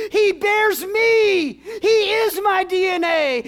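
high, slow, loud pleading cry, near tears; shouted parent's plea. Acted. Parent pleading with God for their baby, tearful shout. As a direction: beg God for the child at full voice.

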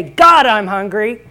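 slow preacher's call; call to repeat. Shown as a demonstration. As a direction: say it slowly for the crowd to echo. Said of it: "Somebody say".

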